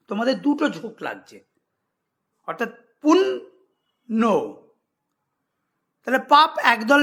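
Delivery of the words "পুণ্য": পুণ্য stretched very slowly into two beats; slow syllable demo. Shown as a demonstration. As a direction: draw out পুণ্য so both syllables are heard. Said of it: "দেখো এই শব্দটা উচ্চারণ করতে". (পুণ্য ধীরে দুই ঝোঁকে উচ্চারণ)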